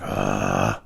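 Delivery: very low, drawn-out "ahh" of rage; mock raging growl. Stewing alone indoors after backing down, mock rage growl. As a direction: growl out pent-up frustration. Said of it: "and I'll start raging".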